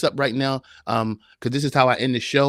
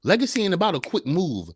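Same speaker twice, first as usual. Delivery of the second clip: ordinary delivery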